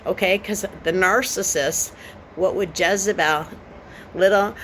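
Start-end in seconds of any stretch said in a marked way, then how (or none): none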